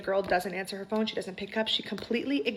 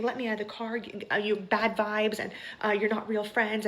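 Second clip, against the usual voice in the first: ordinary talk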